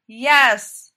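loud cheerful Yes; rowdy chorus reply; group shout. A narrator's reading. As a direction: shout it together with the team. A chorus answers together.

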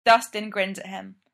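usual speaking voice

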